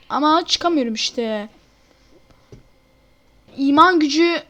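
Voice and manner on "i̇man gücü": usual speaking voice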